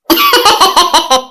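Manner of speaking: put-on short laugh at the old woman's boast; amused laugh. Relative laughing at the grandma's hiding boast. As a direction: chuckle it away. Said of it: "என்ன சிரிக்க?".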